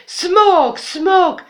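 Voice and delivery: high, loud panicked yell; shrieking alarm at the beast; crowd yelling. Acted. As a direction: scream it like a mob. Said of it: "wrzeszczeli oni".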